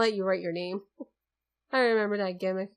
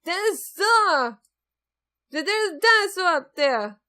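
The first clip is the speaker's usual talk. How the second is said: higher and louder, bursting with excitement; thrilled cry at the dinosaur; excited shout; 7 semitones higher, 6 dB louder, slower than usual. Acted. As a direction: yell it out like a kid spotting a dinosaur. Spots a dinosaur exhibit.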